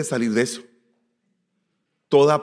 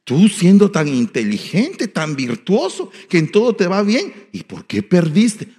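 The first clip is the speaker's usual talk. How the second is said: high, slow, louder mock-mother reproach; drawn-out, raised-pitch imitation of a doting mamá; lovingly oppressive mother; 7 semitones higher, 7 dB louder, slower than usual. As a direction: pile on the praise as a guilt trip. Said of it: "pero de una manera cariñosa".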